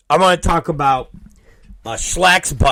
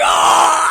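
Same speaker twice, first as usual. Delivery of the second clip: pained cry. Retold or imitated. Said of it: "it's like".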